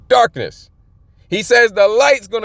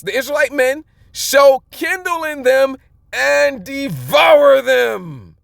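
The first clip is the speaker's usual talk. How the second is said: raised, slowing prophetic shout; fiery preaching; 5 semitones higher, slower than usual. Acted. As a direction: thunder the prophecy, stretching the key words. Preaching scripture at full force.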